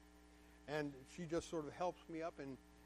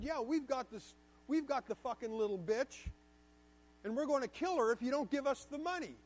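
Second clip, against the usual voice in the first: higher, louder mock thug threat; menacing threat; 7 semitones higher, 5 dB louder than usual. Extortion caller threatening the father, menacing and loud.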